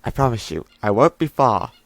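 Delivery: ordinary talk, nothing marked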